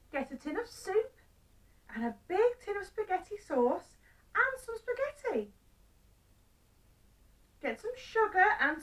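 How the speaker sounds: high-pitched wife reciting a shopping list; wife giving instructions. Acted. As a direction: send him shopping in a high, emphatic voice. Wife voiced, listing the shopping.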